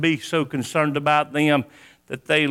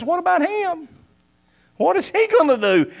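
mock nosy Peter; 11 semitones higher than usual. Mimics Peter questioning about John.

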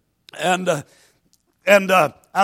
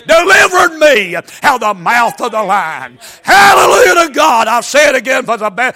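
full-force preaching; 3 semitones higher, 9 dB louder than usual. Acted. Preacher to congregation, loud shouted preaching. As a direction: drive the scripture home with everything you've got.

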